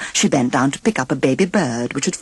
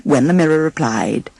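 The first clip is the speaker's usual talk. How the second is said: ordinary delivery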